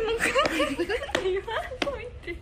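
loud, high pleading through tears of laughter; laughing. Real, not acted. Host pleading with Yuzu, crying with laughter. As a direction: beg her to stop while crying laughing. Begging her to stop kukuku.

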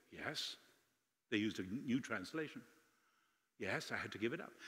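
very soft, low reply, quoted; subdued old lady. Retold or imitated. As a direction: quietly voice an elderly woman's reluctant admission. Retold old lady's reluctant, subdued answers.

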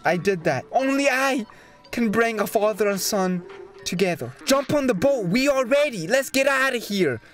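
ordinary talk

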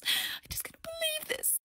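indignant whisper; whispered huff. Acted. Fake celebrity huffing to herself in a whisper. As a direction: huff under your breath.

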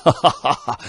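loud, high burst of laughter; hearty laugh. A narrator's reading. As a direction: laugh out in relief. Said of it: "蓝大将军大笑出声".

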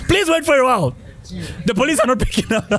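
high-pitched playful pleading; mock plea to thieves. Victim to thieves while police don't answer, mock pleading. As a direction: stall the burglars in a high mock voice. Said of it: "What do you tell the thieves?".

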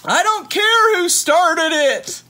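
shrill, slow furious teacher shout; teacher yelling. Acted. Teacher scolding kids in a food fight, high slow yell. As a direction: bellow it over the whole cafeteria.

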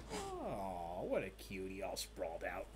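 hushed, low coo; tender cooing. Real, not acted. Host admiring the pups, soft tender cooing. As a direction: coo softly over a sleepy pet. Cooing over a sleeping pup.

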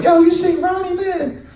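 high, lively barbershop voice; mock nosy barbershop question; barbershop buddy. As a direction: toss it out like a curious friend. Barbershop guys asking about Ronnie.

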